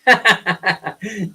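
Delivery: real amused laugh. Real, not acted. Host joking with chat about hair, amused laugh. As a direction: laugh at your own remark.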